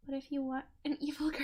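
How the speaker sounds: hushed unvoiced whisper. Real, not acted. Streamer whispering a cheeky question to chat. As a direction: whisper it like a sly secret. Streamer asks quietly aside.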